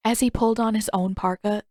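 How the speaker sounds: plain reading voice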